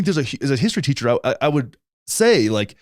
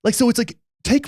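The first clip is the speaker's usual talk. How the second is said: outraged disbelief; 11 semitones higher than usual. Real, not acted. Ranting to his cohost about stolen children, outraged disbelief. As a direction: pitch shoots way up in indignant disbelief.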